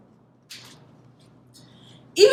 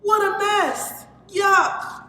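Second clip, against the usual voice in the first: put-on disgusted kid; 10 semitones higher, 3 dB louder, slower than usual. A narrator's reading. Kids disgusted at spilled trash.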